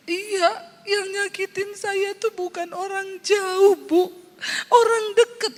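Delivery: high teary voice, breaking into sobs; imitating tearful woman. Congregant tells the pastor who hurt her, tearfully. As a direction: play the hurt congregant choking up. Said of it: "tiba-tiba dia nangis".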